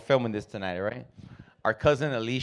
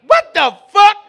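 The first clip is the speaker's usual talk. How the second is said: loud, high, indignant yelling; very loud outraged customer; 10 dB louder than usual. Acted. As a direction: yell it in disbelief, way up high.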